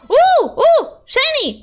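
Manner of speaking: high, loud squeals of delight; excited delight. Real, not acted. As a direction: pipe up high, thrilled by treasure. Thrilled at item appearing in game.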